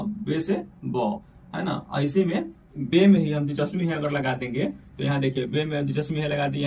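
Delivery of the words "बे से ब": slow, careful letter drill; slow teaching drill. Shown as a demonstration. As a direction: stretch out बे से ब for the class. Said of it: "बे, है ना?". (धीरे-धीरे अक्षर सिखाते हुए)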